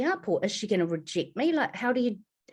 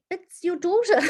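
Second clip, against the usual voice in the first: nervous reveal; 11 semitones higher, 5 dB louder than usual. Retold or imitated. Revealing herself.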